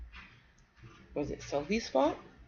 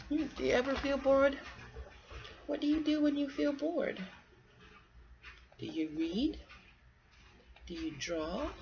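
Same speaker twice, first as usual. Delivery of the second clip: put-on higher, sing-song quizzing for a child; playful questions; 5 semitones higher than usual. Parent quizzing a child listener, playful sing-song. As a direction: coax a kid to answer.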